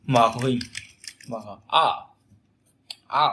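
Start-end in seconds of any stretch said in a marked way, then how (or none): 1.7-3.3 "Á" with mouth opened naturally, short uh sound; relaxed short "Á" from an open mouth; open-mouthed English Á. Shown as a demonstration. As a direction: say "Á" as English uh, without a rising tone. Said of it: "đọc như âm Á, mở khẩu hình".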